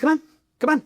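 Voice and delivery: high, pushed taunt; mock-heated come on; taunting dare. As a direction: goad him to follow. Taunting him outside.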